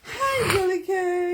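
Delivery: high, loud sing-out greeting; high call-out. Acted. Partner hailing the host from a viewer's comment, high call-out. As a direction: call the name out high and playful.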